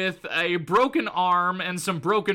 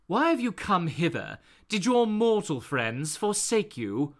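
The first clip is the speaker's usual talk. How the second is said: lightly voiced haughty sorceress; a hint of an imperious goddess; Enchantress; 3 dB quieter than usual. Enchantress questioning a fellow god, lightly voiced regal tone. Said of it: "the Enchantress then asks".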